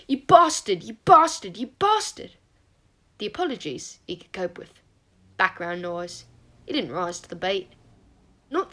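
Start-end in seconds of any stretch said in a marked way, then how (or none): 0.1-2.3 loud, higher-pitched cursing; angry scream. A narrator's reading. Remus imagining screaming at Sirius, furious outburst. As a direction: hurl it out harder each time. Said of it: "scream at Sirius".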